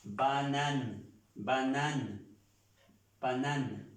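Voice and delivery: "banane" in French, ending on N, no E; "banane" with silent final E; final N sounded. Shown as a demonstration. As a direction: repeat "banane" as a model, falling onto the N. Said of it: "Pronunciamos la N".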